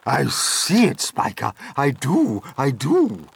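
loud, eager agreement; excited reply. A narrator's reading. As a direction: insist loudly that you see it. Sponge answers Spiker's excited shout.

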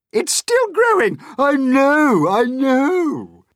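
very high, loud squeals; excited shouting. A narrator's reading. Aunts shouting in excitement at peach.

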